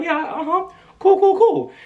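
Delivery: high-pitched mock nonchalance; acting cool. Covering up excitement in front of stars, acted cool. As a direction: play it cool, a little too high. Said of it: "I was out there like".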